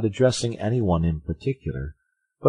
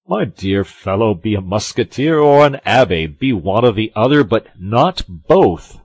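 loud, emphatic musketeer; admonishing Porthos; 5 semitones higher, 11 dB louder than usual. A narrator's reading. Porthos tells Aramis to choose musketeer or abbé, admonishing. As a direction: lay down the choice, drawing out the end.

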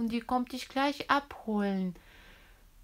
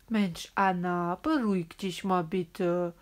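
soothing doctor; 6 semitones lower than usual. Pretend. Doctor soothes scared child.

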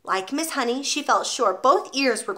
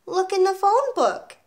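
high, slow schoolboy voice; young pupil answering back; little boy; 10 semitones higher than usual. A narrator's reading. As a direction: pipe it up high and drawn out. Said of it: "Eric said".

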